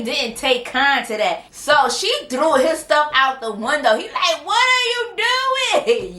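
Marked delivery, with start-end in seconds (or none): 4.4-6.1 high, drawn-out cry of disbelief; mock outraged wail. Husband watches wife throw his stuff out, wailing protest. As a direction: imitate a protesting husband, stretched and pitched way up.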